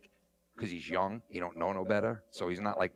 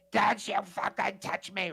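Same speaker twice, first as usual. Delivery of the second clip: much higher, slow furious snap; mock shrieked warning; 9 semitones higher, 4 dB louder than usual. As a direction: scream at the cop to back off. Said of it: "she's like".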